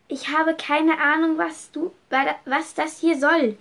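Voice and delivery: high, loud panicked pleading. A narrator's reading. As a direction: plead in rising panic, stumbling. Verängstigt mit Klinge am Hals. (hoch und laut, panisch stammelnd)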